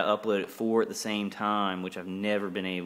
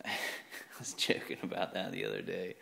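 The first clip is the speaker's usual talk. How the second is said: ordinary delivery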